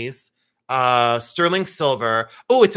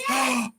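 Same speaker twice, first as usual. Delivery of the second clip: high gasp for breath; gasp after laughing; 8 semitones higher, 4 dB quieter than usual. Real, not acted. Recovering from the near slip, gasping. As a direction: suck in air after a laughing fit.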